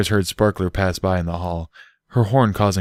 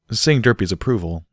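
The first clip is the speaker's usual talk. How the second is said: ordinary delivery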